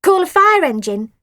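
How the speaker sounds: put-on high, loud child voice; girl's suggestion. A narrator's reading. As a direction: blurt out the idea. Natalie suggesting.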